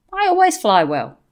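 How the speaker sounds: calm and matter-of-fact; understated calm reply. Retold or imitated. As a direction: say it evenly, giving her nothing. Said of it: "I just calmly said".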